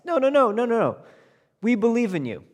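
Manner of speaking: high, loud, cutting him off; overbearing king. Acted. King cutting off the tailor's protest, overbearing and loud. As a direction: steamroll his objection cheerfully.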